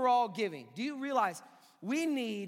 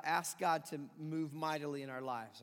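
usual speaking voice

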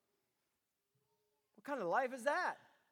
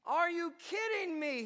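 exasperated; 6 semitones higher than usual. Retold or imitated. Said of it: "he says".

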